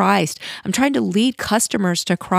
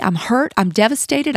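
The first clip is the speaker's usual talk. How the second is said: ordinary talk, nothing marked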